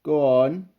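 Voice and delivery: loud husky urging. A narrator's reading. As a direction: urge him on with a sore throat. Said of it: "said the lion huskily to Basil".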